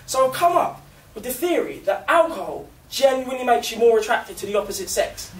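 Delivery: ordinary talk, nothing marked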